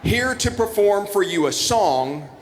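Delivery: slow, lifted grand introduction; slow ceremonial announcer read; formal announcement. Acted. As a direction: announce the guests like a master of ceremonies. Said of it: "what I've been told to read to you to introduce".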